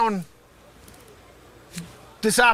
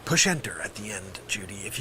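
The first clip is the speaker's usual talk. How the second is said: sudden quiet; 14 semitones lower, 8 dB quieter than usual. Acted. Drops after the yell.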